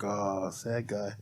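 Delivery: slow, soft, crestfallen murmur; deflated. Retold or imitated. Barber crushed after the customer's insult, slow soft deflated murmur. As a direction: let the air out of yourself, slowly and quietly. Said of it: "It's like".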